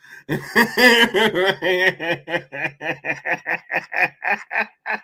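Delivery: high sustained helpless laughter; long wheezing laugh. Real, not acted. Streamer to chat, long high laugh. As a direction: laugh till you run out of air.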